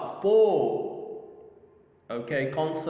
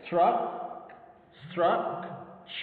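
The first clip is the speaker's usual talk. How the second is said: "structure" broken up as struck, pause, ch; syllable by syllable; 3 semitones higher, much slower than usual. Shown as a demonstration. Teacher dividing structure for learners, syllable by syllable. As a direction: say "Struck" alone, rising, then a separate "ch". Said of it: "Two syllables".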